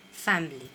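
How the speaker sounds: family said fam-ly; correct pronunciation. Shown as a demonstration. Teacher drilling family for students. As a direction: repeat family, i silent. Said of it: "I is silent over here".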